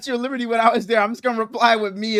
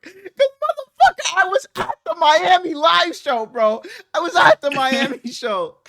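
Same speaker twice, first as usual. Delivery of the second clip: indignant; 6 semitones higher than usual. Real, not acted. Indignant he is from Florida.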